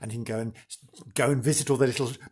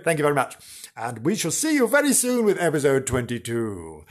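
usual speaking voice

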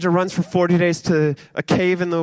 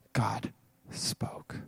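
very soft, low whisper; hushed and low, barely voiced; 8 semitones lower, 14 dB quieter than usual. A narrator's reading. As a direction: whisper the climax. Hushed climax after the silence.

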